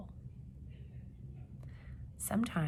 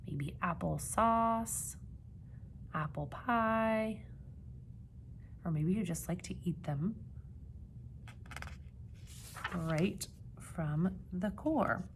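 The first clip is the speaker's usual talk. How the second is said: slow coaxing suggestions; 3 dB quieter, slower than usual. Real, not acted. Teacher suggests slowly to kids.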